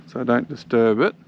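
ordinary talk

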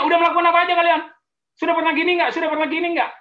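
high, loud and fast, firing questions; probing counselor. Acted. Pastor acts the premarital counselor questioning a couple, loud and quick. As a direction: play a nosy counselor grilling the couple.

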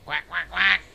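repeated imitation quacks; silly duck noises in short bursts; duck quacking. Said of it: "Stop being a duck".

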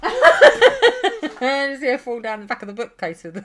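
talking through high, loud laughter; laughing. Real, not acted. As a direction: laugh your way slowly through the words. Laughing at her cat.

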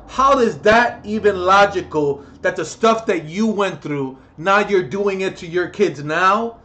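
loud, furious shouting at abusive parents; angry rant. Real, not acted. Ranting at abusive parents on camera, loud furious shouting. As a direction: yell it at them, really furious. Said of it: "I'm getting too annoyed".

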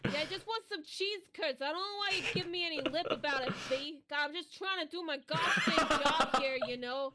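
brash, loud Brooklyn complaining; loud Brooklyn accent. Acted. Fed-up Brooklyn cheese seller griping at a customer, loud Brooklyn accent. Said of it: "[name] had a really good frickin' Brooklyn accent just now".

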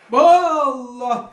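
loud cry of God's name. Retold or imitated. Gasping God's name in the earthquake vision, loud cry. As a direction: call out in overwhelmed awe.

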